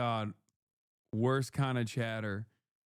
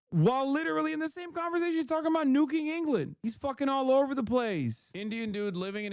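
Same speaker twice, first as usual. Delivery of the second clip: incredulous rant; 10 semitones higher, faster than usual. Real, not acted. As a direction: blurt it out in disbelief. Ranting at absurd chatter.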